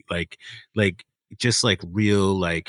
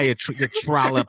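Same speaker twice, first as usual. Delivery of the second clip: put-on Kaz's New York accent; 5 semitones higher than usual. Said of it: "Kaz is just like".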